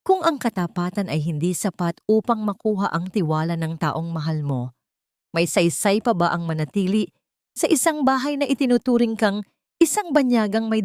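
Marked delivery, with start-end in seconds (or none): none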